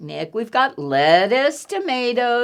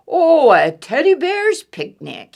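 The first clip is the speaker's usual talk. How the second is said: bellowing bear; 6 semitones higher, 6 dB louder than usual. Acted. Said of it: "he bellowed".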